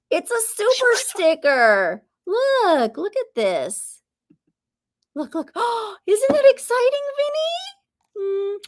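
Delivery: high-pitched cooing to a bird; excited pet talk. Real, not acted. Owner showing Vinny the viewer's gift, excited. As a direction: coax a pet with high, drawn-up questions.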